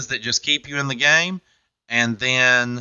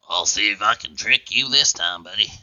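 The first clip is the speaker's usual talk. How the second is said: lower, smoke-roughened voice for the mascot; smoky mascot voice; 6 semitones lower than usual. Retold or imitated. Host voicing Fluffy teasing the contestant, lightly lowered voice. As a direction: play the mascot sly after years of smoking. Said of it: "that's actually him if he's been smoking".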